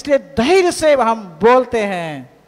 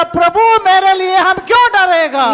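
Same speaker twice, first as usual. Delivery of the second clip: loud, high, fearless; bold declaration; 7 semitones higher, 8 dB louder than usual. Shown as a demonstration. Pastor leading the church in a fearless cry, loud. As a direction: shout down the fear. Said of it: "हम बोलेगा".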